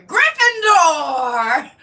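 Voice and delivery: slow, stretched, laughing proclamation; sorting hat call. Pretend. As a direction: announce it like the hat. Imitating the sorting hat calling.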